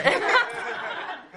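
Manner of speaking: a high real laugh bursts out; high burst of laughter. Real, not acted. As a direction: laugh high and free at the memory. Laughing at the story.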